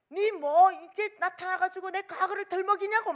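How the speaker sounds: high, loud indignant mimicry; imitated angry outburst. Friend furious at having the past dragged up, imitated angry outburst. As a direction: act out the friend flaring up, pitched high and loud. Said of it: "화를 내는 거예요".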